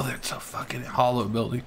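annoyed unvoiced whisper; hushed exasperation. Real, not acted. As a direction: breathe it in disbelief. Hiding, realising cover is bad.

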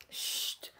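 soft unvoiced shush; whispered shush. Real, not acted. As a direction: breathe the shush out. Hushing the child.

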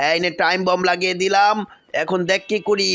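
low, menacing villain voice, gloating; low villain gloating over the bike; menacing Venom. Acted. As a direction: plant the bomb like a smug monster.

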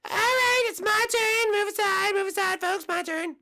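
high, loud cartoon mushroom voice; squeaky eager little guy; Toad puppet voice. Toad pushing forward to speak.

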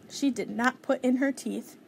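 usual speaking voice

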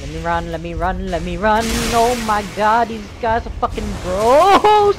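panicked. Real, not acted. Fleeing enemies in game.

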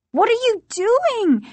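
very high frightened cry; high, horrified question; alarmed repeat. A narrator's reading. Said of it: "she repeated".

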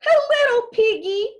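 high, loud playful tease; teasing, amused Mandy. A narrator's reading. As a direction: tease merrily in a high cook's voice. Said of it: "Mandy laughed".

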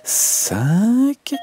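compte qui monte de plus en plus aigu; comptant ses canetons. Acted. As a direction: chaque nombre plus haut, comme une cane. (la mère cane compte ses petits d'une voix qui grimpe)

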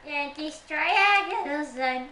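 singing slowly, voice cracking upward. Real, not acted. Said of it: "That was a voice crack".

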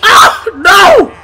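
high, loud cry of dismay; real frustrated shout; anguished yell. Real, not acted. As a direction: scream it as victory slips away. Pin attempt fails in game.

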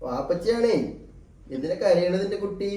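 tender, lifted voice to an infant. Real, not acted. Father cooing to crying baby.

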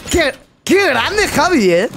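high, drawn-out, slow mock admiration; slow, high, hammed-up praise for a silent teammate; mock awe. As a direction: stretch "qué grande" like exaggerated applause.

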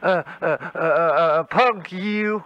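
long stammer before the insult; stuttering. Acted. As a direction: get stuck repeating short words. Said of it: "You can't talk without stuttering".

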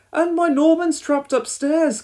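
very high, loud panicky voice; panicked cry. A narrator's reading. Dilys tells firefighters Norman is trapped, panicked cry. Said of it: "cried Dilys in a panic".

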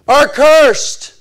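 loud, high pronouncement; ringing, emphatic verdict; full-force preaching. Real, not acted. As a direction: thunder it out from the pulpit.

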